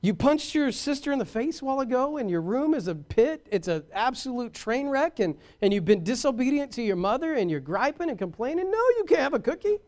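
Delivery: high-pitched mock parental rant; indignant dad. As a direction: scold the kid, climbing to a squeaky high refusal. Acted dad refusing misbehaving child.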